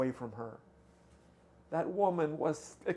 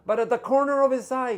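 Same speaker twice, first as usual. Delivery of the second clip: building excitement; 9 semitones higher, 11 dB louder than usual. A narrator's reading. Rising to the climax of the story.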